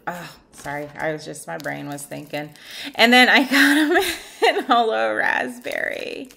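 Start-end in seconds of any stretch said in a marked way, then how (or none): 2.9-6.3 laughing through the words, louder. Real, not acted. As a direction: giggle while you say it. Laughs while showing new glitter.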